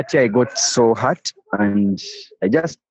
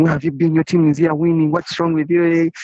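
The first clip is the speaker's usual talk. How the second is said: retold shout; 6 semitones higher than usual. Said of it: "They all shouted".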